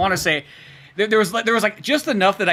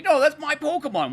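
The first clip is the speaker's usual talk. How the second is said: put-on indignant kid; 10 semitones higher than usual. Kid insists on his claim.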